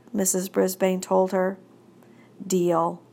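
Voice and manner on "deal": usual speaking voice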